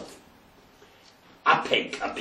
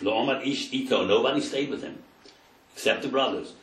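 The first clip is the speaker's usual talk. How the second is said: ordinary talk, nothing marked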